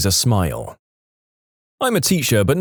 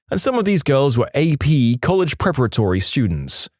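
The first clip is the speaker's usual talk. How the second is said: usual speaking voice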